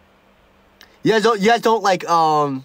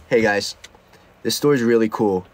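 retold self, low; 8 semitones lower than usual. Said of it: "I was like".

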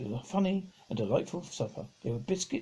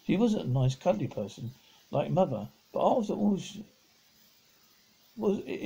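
ordinary delivery, nothing marked

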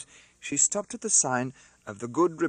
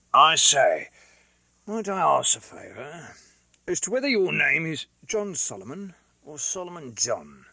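lightly voiced rough Londoner; Mister Cruncher, hoarse; 4 semitones higher, 6 dB louder than usual. A narrator's reading. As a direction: blurt in as a blunt, hoarse fellow. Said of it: "hoarsely and unexpectedly interposed".

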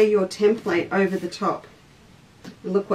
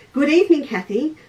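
lifted, louder cheerful hello to a newcomer; bright greeting; 6 semitones higher, 5 dB louder than usual. Real, not acted. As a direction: call out a happy welcome. Greeting viewers as they arrive.

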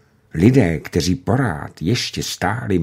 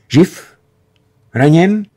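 high, loud anxious calls; urgent questions; 10 semitones higher, 7 dB louder, slower than usual. A narrator's reading. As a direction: fire the questions up at the pilot. Commander rushing to the cockpit.

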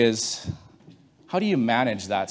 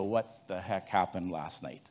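soft, slow, lower, dazed; stunned disbelief; 5 semitones lower, 9 dB quieter, slower than usual. Retold or imitated. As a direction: ask it slowly in quiet shock. Farmer sees trees blown down.

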